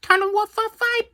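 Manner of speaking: high, fast, laughing through the words; giggling. Real, not acted. Kid ending the puppy review, laughing high and fast. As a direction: squeal it out while giggling.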